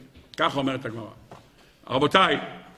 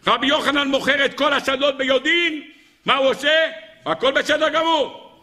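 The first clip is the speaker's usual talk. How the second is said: high-pitched, astonished proclamation; ringing preacher's exclamation; impassioned preaching; 11 semitones higher, 4 dB louder than usual. Acted. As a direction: cry out the loss to the congregation.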